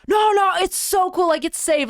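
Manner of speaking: high, eager mock reassurance; cousin hyping it up. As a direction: sell it like an overexcited cousin. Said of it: "my cousin, he's like".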